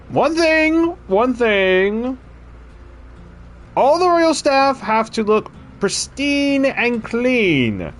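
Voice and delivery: king lecturing his new servant, pompous and slow; regal king voice. Acted. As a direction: lay down the rules like a fussy monarch. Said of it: "I could do the voice".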